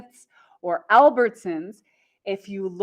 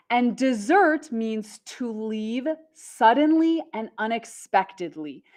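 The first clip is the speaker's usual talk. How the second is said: ordinary talk, nothing marked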